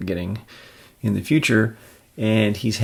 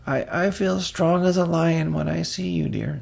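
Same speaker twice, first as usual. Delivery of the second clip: put-on higher, fond old-woman voice; sweet old aunt; 9 semitones higher than usual. Aunt May cooing at Peter in an old-woman voice. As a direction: coo at your nephew.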